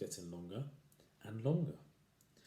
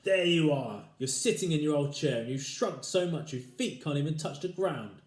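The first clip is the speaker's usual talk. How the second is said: louder triumphant shout; shouting husband; 6 semitones higher, 8 dB louder than usual. Acted. Husband shouting in triumph at his wife, loud. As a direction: crow it out, pointing. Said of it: "pointed at her and shouted".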